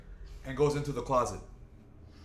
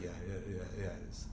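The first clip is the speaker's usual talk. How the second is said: sheepish admission; 5 semitones lower, 11 dB quieter, much faster than usual. Retold or imitated. Admitting to mom sheepishly.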